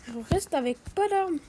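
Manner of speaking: normal talking voice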